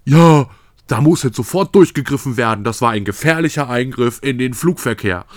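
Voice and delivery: loud, indignant Boomer voice; puffed-up indignation, hit hard at the start; mock outrage. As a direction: imitate an outraged older complainer. Acts out the outraged Boomer reaction.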